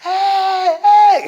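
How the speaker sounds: high, loud shout down the street. Retold or imitated. Chasing the lady up Gran Vía, shouting after her. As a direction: yell to stop her from far away. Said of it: "empiezo a gritar".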